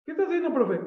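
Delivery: put-on high, curious kid voice; lightly voiced puzzled pupil; child's question. Boy asking his teacher, high curious child voice.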